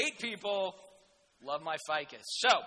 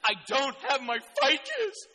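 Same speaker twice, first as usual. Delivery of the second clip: mock whining; 6 semitones higher, 9 dB louder, slower than usual. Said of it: "Like, you know".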